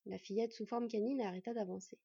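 ordinary delivery

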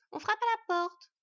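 high, drawn-out shout; loud, slow, high wifely call. Acted. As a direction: holler the news to another floor. Wife shouts down the tube.

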